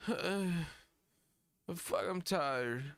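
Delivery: high, tired, muddled rapper parody. Acted. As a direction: stumble through your own stage name, worn out. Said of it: "that's a fucking [name] performance".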